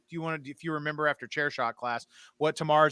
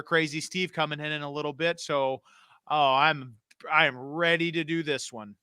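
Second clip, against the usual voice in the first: ordinary talk, nothing marked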